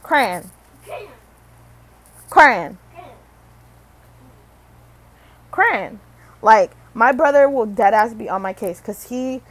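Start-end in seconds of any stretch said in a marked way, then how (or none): 0.0-6.0 "crayon" as one short syllable, "cran"; said fast. Shown as a demonstration. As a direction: toss out "crayon" as a quick "cran". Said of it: "You say it fast".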